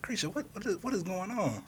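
high, startled cry of confusion; baffled disbelief. Retold or imitated. Dad asking daughter what's happening, pitched high in shock. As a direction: ask it like you can't believe your eyes. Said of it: "And I'm like, I'm like".

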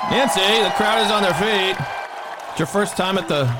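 grand entrance announcement. Acted. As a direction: introduce her like a boxing champ. Host hyping guest like arena.

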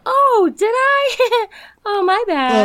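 high, slow, airy playing dumb; feigned innocence, ditzy and sweet; fake innocent. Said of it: "I'm just, like, twirling my hair".

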